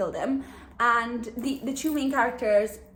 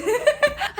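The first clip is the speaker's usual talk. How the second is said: giggle; 7 dB louder than usual. Real, not acted. Giggling at her own wish.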